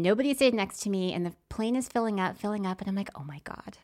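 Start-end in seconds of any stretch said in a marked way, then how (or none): 3.1-3.8 soft whispered excitement; hopeful whisper. Retold or imitated. Spotting an empty row, whispered hope. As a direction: whisper it, hardly daring to hope.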